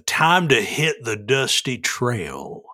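slow, drawn-out Western twang; cowboy dialect. Shown as a demonstration. Listing cowboyisms, a slow Western drawl. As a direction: take your time like an old cowboy. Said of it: "I will do this in dialect".

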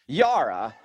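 "Yara" said as it looks spelled; pronunciation as spelled. Retold or imitated. Podcaster quoting commenters' spelling, open-ah pronunciation. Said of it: "it's pronounced ... spelled out a word".

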